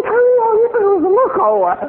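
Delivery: mock bewildered question; incredulous. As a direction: ask it baffled and amused. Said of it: "我说".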